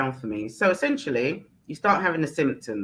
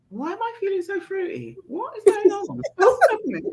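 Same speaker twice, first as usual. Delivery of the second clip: much higher, puzzled, laughing; mock baffled voice. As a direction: play it baffled and giddy. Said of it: "And I'm like".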